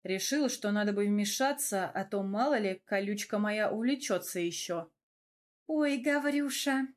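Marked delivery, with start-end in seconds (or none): 5.7-6.9 drawn-out, higher, sweetly sly address; insinuating. A narrator's reading. Велора wheedles Гаврюша before she strikes, insinuating. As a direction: coax him slyly, stretching the words. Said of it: "вкрадчиво проговорила Велора".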